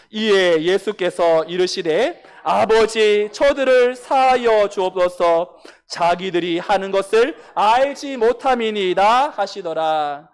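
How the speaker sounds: loud, slow solemn reading together; scripture reading. Real, not acted. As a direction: read the prayer out slowly and loudly. Congregation reads scripture aloud.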